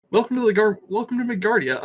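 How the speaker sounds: high-pitched mock welcome; big self-mocking welcome; mock announcer. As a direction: announce it like a cheesy show host. Mock show announcement of the setting.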